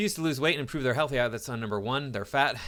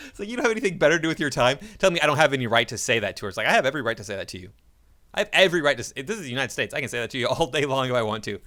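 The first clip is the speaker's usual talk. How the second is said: fast, loud and indignant; heated rant; 2 semitones higher, 6 dB louder, faster than usual. Real, not acted. Vlogger defending his gym remark, fast and loud. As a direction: fire it off, fed up and defiant. Ranting about woman who yelled.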